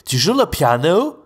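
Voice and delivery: tu joues le piano sung high and slow with big rise; mock anglophone accent in French; anglophone melody. Shown as a demonstration. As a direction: exaggerate the English lilt on le piano. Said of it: "Vous voyez la différence entre".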